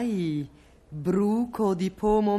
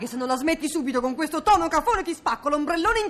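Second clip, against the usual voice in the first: shrill angry threat; 7 semitones higher, much faster than usual. Acted. Escalating quarrel, high.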